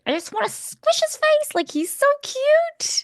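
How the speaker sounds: very high squeaky cooing voice; baby-talk gush. Retold or imitated. Friend gushing over sister's baby, squealing high baby talk. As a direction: coo like you're squishing a baby's cheeks.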